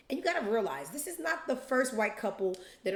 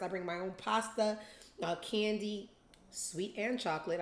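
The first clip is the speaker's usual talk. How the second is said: ordinary delivery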